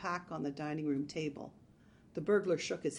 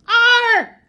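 short, loud, high-pitched scream; sudden high yelp of panic; terrified scream; 21 dB louder than usual. A narrator's reading. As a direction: scream out in alarm. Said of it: "screamed the burglar".

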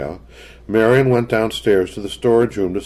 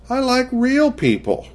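put-on high little girl voice; young girl voice. A narrator's reading. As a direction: say it like a stubborn child. Child answering mother.

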